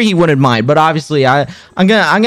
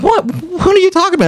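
high-pitched retold disbelief; baffled protest, voice jumping up; incredulous; 11 semitones higher, faster than usual. Retells own disbelief at apology.

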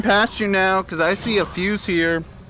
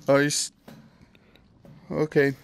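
normal talking voice

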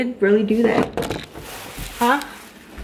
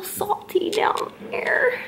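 slow, soft teasing whisper; whispered jab at boyfriend; 6 semitones higher, 6 dB quieter, slower than usual. Acted. Teasing aside about boyfriend.

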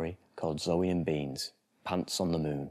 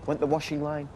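put-on lively storybook reading for small children; bedtime story narration; 5 semitones higher than usual. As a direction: read it like a bedtime picture book.

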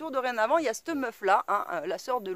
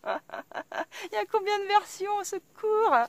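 high, drawn-out comic disbelief; mock horror; 10 semitones higher, slower than usual. Driver spotting yet another lookalike, mock-horrified high wail.

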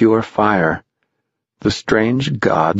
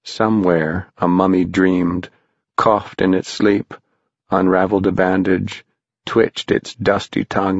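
ordinary delivery

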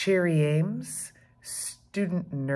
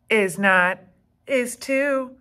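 louder, higher quarrel with brother; bickering; 7 semitones higher, 7 dB louder than usual. Acted. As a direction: snap back at little brother. Sibling quarrel.

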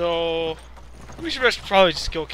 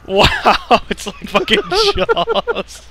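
laughing; 6 semitones higher, 5 dB louder, much slower than usual. Real, not acted. Laughing at partner in water.

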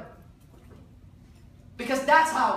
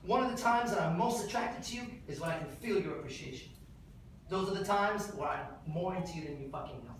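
lower, softer sincere confession; intimate tone; 6 semitones lower, 7 dB quieter than usual. Shown as a demonstration. Showing how to tell a partner you feel appreciated, softer and lower. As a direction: say it close and tender, lower. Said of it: "You say it like this".

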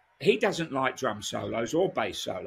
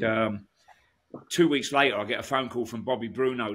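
normal talking voice

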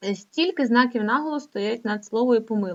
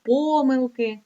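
помилки stressed on the first syllable, ПО; wrong first stress; 3 semitones higher, 4 dB louder, slower than usual. Shown as a demonstration. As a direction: lean on ПО in помилки. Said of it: "Не можуть бути".

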